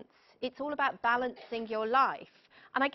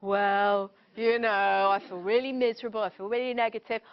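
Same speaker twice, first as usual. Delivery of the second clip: louder, put-on mopey voice of a negative friend; moaning drain; 5 dB louder than usual. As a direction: whine out your misery to drain them. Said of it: "And they go".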